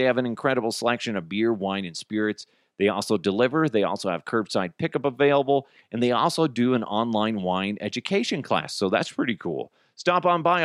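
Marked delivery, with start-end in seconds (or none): none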